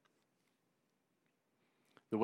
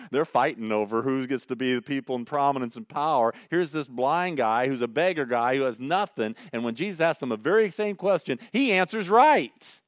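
louder, higher, building to a punch; fervent preaching; 3 semitones higher, 9 dB louder than usual. Real, not acted. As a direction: drive the point home with rising fervour. Preacher builds to emphatic point.

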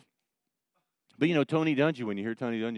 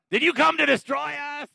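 high, loud demon screech; demonic voice; 6 dB louder than usual. Shown as a demonstration. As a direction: cry out as a threatened demon. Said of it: "here's my demonic voice".

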